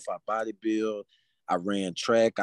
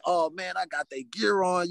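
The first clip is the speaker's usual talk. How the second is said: happy; 6 semitones higher than usual. Retold or imitated. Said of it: "I'm so happy".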